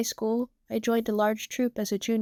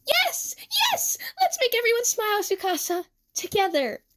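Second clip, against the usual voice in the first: high, excited burst easing down towards the end; overjoyed; 12 semitones higher than usual. A narrator's reading. Emu agreeing to do shows with Tsukasa, overjoyed. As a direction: squeal your delighted yes, high and quick.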